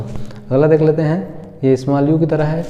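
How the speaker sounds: ordinary talk, nothing marked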